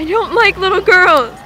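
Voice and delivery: slow, high mocking imitation; mocking quote. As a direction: parrot her words, hammed-up and slow. Mocking what a woman said.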